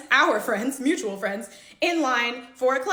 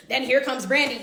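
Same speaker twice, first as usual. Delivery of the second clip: ordinary talk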